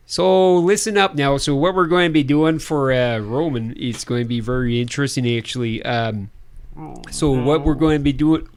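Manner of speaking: put-on Midwestern announcer; Midwestern accent. Co-host announces projects in a put-on Midwestern accent. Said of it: "a decent Midwestern accent".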